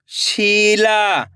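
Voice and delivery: Sheila joined as one slow word; blended word. Shown as a demonstration. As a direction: read Sheila whole with drawn-out vowels, falling at the end. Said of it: "खींच के पढ़ना है".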